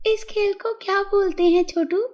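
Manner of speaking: high-pitched fairy voice, curious question; naughty fairy. A narrator's reading. Fairy asks Chhotu the game's name, high curious voice. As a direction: ask as a fairy, pitched well up. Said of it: "नटखट परी छोटू के पास गई और बोली".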